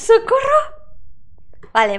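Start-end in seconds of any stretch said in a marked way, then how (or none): 0.0-0.8 high panicked yelling; alarm shout. Real, not acted. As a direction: cry out in real fright. Ghost following her.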